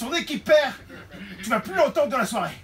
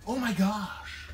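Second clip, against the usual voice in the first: breathy awed gasp; 5 dB quieter, much slower than usual. Acted. Kate gushing, drawn out softly.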